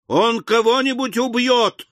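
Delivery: very high alarmed cry; shrieking. A narrator's reading. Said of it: "завизжали женщины". (испуганный визг)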